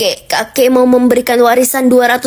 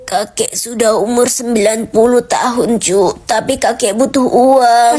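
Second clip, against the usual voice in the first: put-on old man voice; 5 semitones lower than usual. Old grandpa seller voice.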